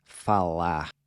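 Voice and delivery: falar ending open, no R sound; silent final R. Shown as a demonstration. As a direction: say falar as fala, R dropped. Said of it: "treat it almost as a silent letter".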